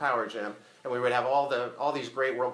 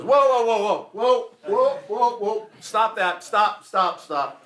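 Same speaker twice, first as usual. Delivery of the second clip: high, loud shouted stop to a band; loud, high-pitched call for the band to stop; shouting the band to stop; 7 semitones higher, 8 dB louder, slower than usual. Retold or imitated. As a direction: yell at the band to cut it, fading at the end. Rodney halts the band.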